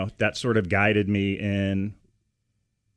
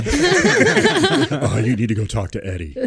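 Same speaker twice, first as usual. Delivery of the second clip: coworker's reply; 3 semitones higher, 5 dB louder, slower than usual. Retold or imitated. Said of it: "and I was like".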